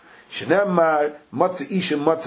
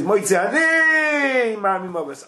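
very high, slow drawn-out Moitze ani; drawn-out quote; 7 semitones higher, slower than usual. Shown as a demonstration. Teacher chanting the verse, drawn out. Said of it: "it says".

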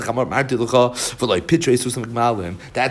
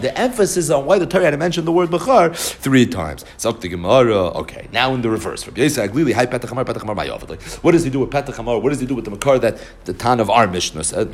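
ordinary talk

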